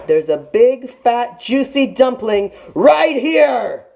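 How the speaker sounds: high, loud and slow, savouring each word; a tiger drooling over its prey; gloating tiger. A narrator's reading. Tiger to Little Sister, gloating over the dumpling.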